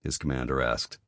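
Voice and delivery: ordinary delivery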